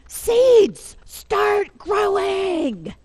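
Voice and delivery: high, loud, long-drawn shout; storybook yell. A narrator's reading. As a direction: yell it at the seeds, stretching every word. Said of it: "Then he begins to yell".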